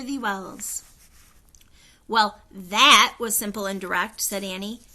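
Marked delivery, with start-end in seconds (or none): 2.1-4.2 louder dry quip; wry remark. A narrator's reading. Annie commenting on the queen, dry and loud. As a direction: toss it off wryly.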